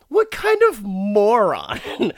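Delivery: loud, slow, high disbelief; exasperated. Retold or imitated. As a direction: spit out the mockery slowly. Mocking the character's stupidity.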